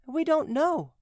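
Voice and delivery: put-on children telling the giant they don't know; children answering. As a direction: answer like small children, pitched high. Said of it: "answered the children".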